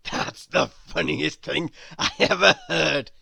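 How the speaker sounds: loud, laughing words from a bear. Acted. Grizzle finally laughing at the Wizard's joke, laughing. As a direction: say it through happy laughter. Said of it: "he laughed".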